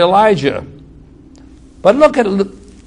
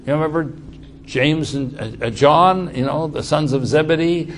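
usual speaking voice

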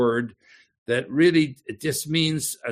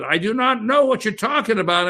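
high, loud protest, voice raised; heated denial; 9 semitones higher, 5 dB louder than usual. Acted. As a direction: Peter shouting his denial at the accuser.

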